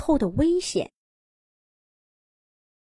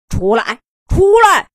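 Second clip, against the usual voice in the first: roaring bear; 7 semitones higher, 8 dB louder than usual. A narrator's reading. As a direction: bear roar building to a much louder, higher shout. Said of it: "棕熊继续咆哮着". (棕熊咆哮，一声比一声响)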